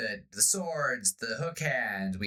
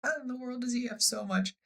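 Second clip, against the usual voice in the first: put-on amazed boy; 13 semitones higher than usual. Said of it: "you're like".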